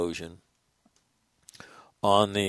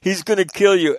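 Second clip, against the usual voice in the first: louder, higher retold warning; mock alarmed warning; 8 semitones higher, 5 dB louder than usual. Imitating marines warning their buddy, lightly hammed-up alarm. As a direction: warn a buddy he's in big trouble. Marines warn picture taker.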